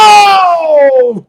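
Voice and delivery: high, loud drawn-out whoa; loud whoa sliding down from high; big shocked whoa. Acted. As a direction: let out a long, booming whoa. Mock shock at bracket result.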